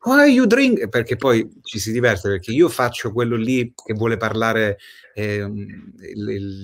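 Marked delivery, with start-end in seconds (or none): 0.0-0.9 high, loud Sicilian English; mock a Sicilian speaking English; Sicilian accent. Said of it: "il siciliano che parla inglese".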